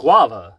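"Guava" said as "GWAH-vuh"; corrected pronunciation. Shown as a demonstration. Parent correcting child, loud "Guava" as "GWAH-vuh". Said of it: "one of those words you can't pronounce".

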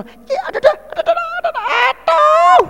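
very high, crying mock wail of pain; exaggerated pain wail. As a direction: ham up a patient wailing aduh.

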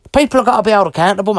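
rushed, heated insistence; fast insistent. Real, not acted. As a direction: rattle it off quickly and firmly. Repeats the point, first fast.

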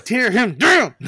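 high, loud gleeful cheer. Retold or imitated. Fan urging the judge on, loud and gleeful. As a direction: egg him on with glee. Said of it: "And I'm just like".

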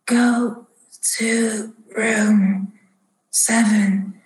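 slow, halting rasp, word by word; raspy man in a trance. Acted. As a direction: rasp it out with long gaps, straining up on the last word. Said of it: "like, in a trance".

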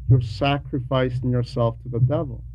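normal talking voice

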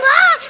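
high, hammed-up screams of a frightened woman; mock panicked scream. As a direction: scream like she's terrified in the water. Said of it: "She's backing down and saying".

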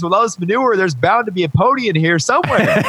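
high, laughing punchline; gleeful kid. Retold or imitated. Cheerful boy explaining to researchers, high and laughing. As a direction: beam through the line with a laugh.

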